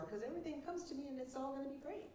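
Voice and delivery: smug confidence. Retold or imitated. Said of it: "my attitude was".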